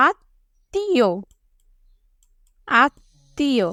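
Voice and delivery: আত্মীয় very slow, split into syllables; slow teacher's syllable-by-syllable demo; sounded out. Shown as a demonstration. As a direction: hold each part of আত্মীয়, "aat... tee-o". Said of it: "আমরা কিভাবে উচ্চারণ করি".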